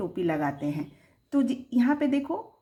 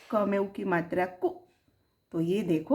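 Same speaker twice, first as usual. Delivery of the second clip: normal talking voice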